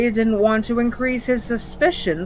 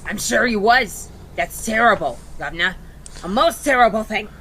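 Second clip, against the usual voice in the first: cockney imp voice; 3 semitones higher, 3 dB louder, faster than usual. Acted. Imp speaks in role.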